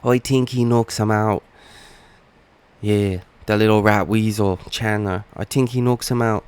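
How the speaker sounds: lightly put-on Irish accent, a hint of Conor; a half-hearted stab at an Irish brogue, trash talk; bad Conor McGregor impression. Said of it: "that was a horrible Conor McGregor impression".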